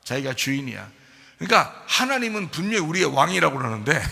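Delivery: ordinary talk, nothing marked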